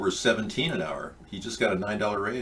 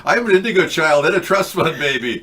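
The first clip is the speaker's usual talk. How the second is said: higher, louder laughing boast; laughing brag; 7 semitones higher, 9 dB louder than usual. Pretend. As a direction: crack up while bragging. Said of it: "Tell that to people".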